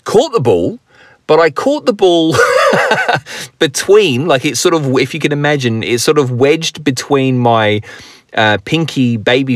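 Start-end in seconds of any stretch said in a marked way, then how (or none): 2.4-3.5 hearty laugh. Real, not acted. Laughing at his own broken-finger story.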